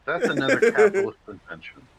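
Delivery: high, loud mock Russian accent; hammy Russian comrade scolding. Russian bit again.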